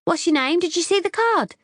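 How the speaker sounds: high, eager chatty questions; keen and breezy; bubbly girl voice. A narrator's reading.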